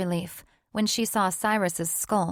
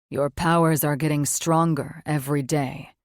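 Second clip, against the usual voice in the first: a touch lower, tired demon; low, subdued male voice; lightly voiced weary man; 3 semitones lower, 4 dB louder than usual. Male character voice.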